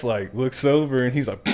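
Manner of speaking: ordinary delivery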